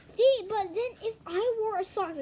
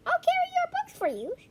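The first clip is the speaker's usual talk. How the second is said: put-on girl's voice; 6 dB louder than usual. Nancy offering to carry books.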